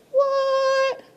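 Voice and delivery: a very high, hammed-up "What?"; mock astonished squeal. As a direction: act stunned by the discovery, way up high. Feigned astonishment at the eight.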